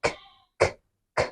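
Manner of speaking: খ with a strong puff of air; aspirated kh drill. Shown as a demonstration. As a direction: repeat খ, breathy and sharp. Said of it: "খ sound করতে হবে".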